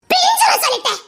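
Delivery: high, sharp-pitched threat; angry warning. Acted. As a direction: warn them off angrily, pitch jumping up. Threatening warning in family quarrel. (கோபமான எச்சரிக்கை)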